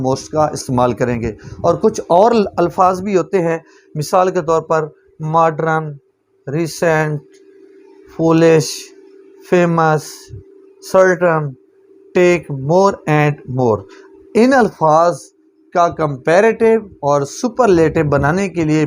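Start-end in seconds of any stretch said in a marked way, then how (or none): 5.2-13.8 very slow, word by word rule reading; slow dictation. Shown as a demonstration. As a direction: read the rule out slowly for notes. Reads rule ending aloud. (धीरे-धीरे नियम पढ़ना)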